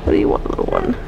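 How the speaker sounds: hushed, coaxing question; whisper. Real, not acted. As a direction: whisper it gently, barely any voice. Player softly addressing game child.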